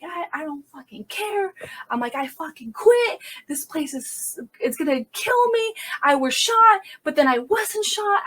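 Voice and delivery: high-pitched, heated retold rant; worked-up, cursing outburst in retelling; venting.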